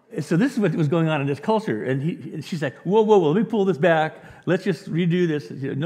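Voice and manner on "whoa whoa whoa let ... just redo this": louder, higher mock alarm; alarmed halt. Translator pulling back her wrong translation, alarmed halt. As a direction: cut in loudly to stop everything. Translator halting a mistaken reading.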